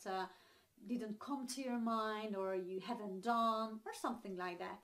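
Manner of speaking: ordinary talk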